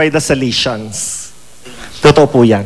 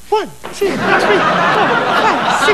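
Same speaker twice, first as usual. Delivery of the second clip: put-on girly counting; 13 semitones higher than usual. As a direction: count it up high and prissy like a giddy girl. Counting kicks when priests leave. (pabebe na bilang, mataas at mahina)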